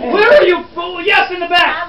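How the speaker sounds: high, loud cry across the room; exasperated call. Acted. Reporter calling on someone in the back, exasperated. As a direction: call out to find the speaker at the back. Hunts for next student.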